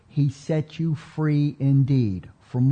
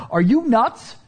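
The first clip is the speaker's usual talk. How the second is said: laughing incredulous; 9 semitones higher, 4 dB louder than usual. Retold or imitated. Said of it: "That's my translation".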